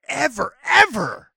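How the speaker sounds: both "ever"s pitched high, loud and drawn out; emphatic denial. Pretend. Co-host swearing Howie never gets stink pumped, stressing "ever". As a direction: stretch and punch each "ever".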